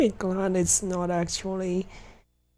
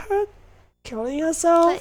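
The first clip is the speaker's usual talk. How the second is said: higher and louder, appalled question; incredulous; 8 semitones higher, 6 dB louder than usual. Real, not acted. Streamer calling out a game character's callousness, incredulous. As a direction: push the question up, unable to believe it.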